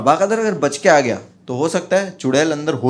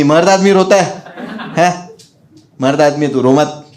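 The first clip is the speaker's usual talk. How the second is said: louder teasing scold; mock scolding; 5 dB louder than usual. Narrator scolding crying Bhura, louder teasing tone. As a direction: tease him to stop crying.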